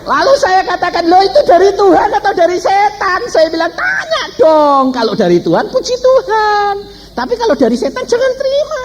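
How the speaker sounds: very high, exclaiming sermon voice; fervent preaching. Retold or imitated. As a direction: cry out the retold advice to the congregation. Preacher retells advice with full force.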